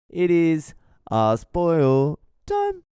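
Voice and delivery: high, slow showman announcement; game show announcer. Acted. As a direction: announce the segment big. Podcast segment announcement.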